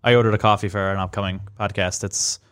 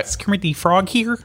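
high puppet frog voice; high, put-on frog puppet voice; Kermit the Frog; 7 semitones higher than usual. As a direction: do the Muppet frog, pitched up. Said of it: "A little higher".

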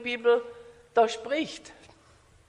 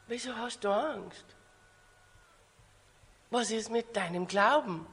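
much softer, unhurried question; calm Jesus; 2 semitones lower, 9 dB quieter than usual. Acted. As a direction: ask it quietly amid the panic. Jesus woken, answers the panicked disciples. (leise und gelassen fragen)

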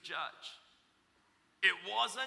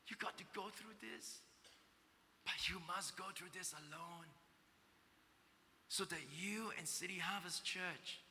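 much softer, hushed and tender; tearful whisper; 8 dB quieter than usual. Acted. God comforting his son, soft and tearful. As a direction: speak softly through tears. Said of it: "whispering to me ... tears in his voice".

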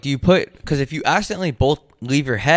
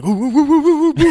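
high excited caveman hooting; caveman impression; 6 dB louder than usual. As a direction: hoot like an ecstatic caveman. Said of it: "This is my impression of a caveman getting head".

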